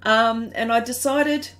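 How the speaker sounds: usual speaking voice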